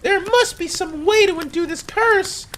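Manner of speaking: very high strained plea; desperate cry. Acted. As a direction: wail in a high put-on voice. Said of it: "I screamed aloud".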